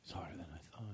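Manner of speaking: very quiet, low, halting request; hushed acting. Friend asking for a favor about visitors from out of town, hushed and halting. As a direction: play it small and hushed, with hesitant pauses.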